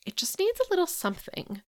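high, airy, vague client voice; vague client feedback. Retold or imitated. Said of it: "revision requests that say things like".

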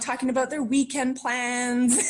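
normal talking voice

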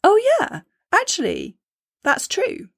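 pleasant realisation. Retold or imitated. Inner chatter happily agreeing with the affirmation, high and surprised. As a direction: realise it is true after all. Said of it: "your internal chatter may well say".

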